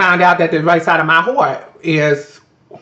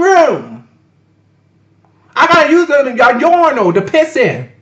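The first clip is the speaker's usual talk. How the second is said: heated rant; 9 semitones higher than usual. Real, not acted. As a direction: shout it out, still livid at the accusation. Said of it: "Ooh, girl, I was so heated".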